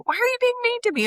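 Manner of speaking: high, fast whining complaint; high mock whine of a complaining client; whiny client. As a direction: whine like a hurt customer. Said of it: "we get somebody saying".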